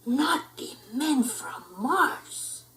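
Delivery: slow, soft spooky voice; ominous. Acted. Pup declaring doom, slow spooky voice. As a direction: draw it out ominously. Fearful pronouncement.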